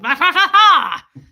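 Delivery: loud, high, drawn-out mock German barking; booming mock-German accent; terrifying German gibberish. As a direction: imitate scary German with nonsense syllables. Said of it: "terrifies you and speaks in terms of".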